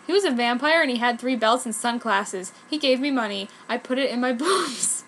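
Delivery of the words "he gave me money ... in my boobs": ordinary delivery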